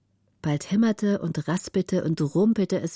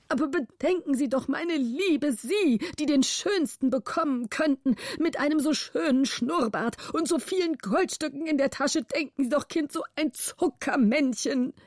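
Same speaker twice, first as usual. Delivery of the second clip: mild, high pleading guardian, half whining; almost tearful pleading; 7 semitones higher than usual. A narrator's reading. Guardian begging the countess to take the rich suitor, mildly tearful. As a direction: coax her like a fretful old uncle. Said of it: "sagte er fast weinerlich".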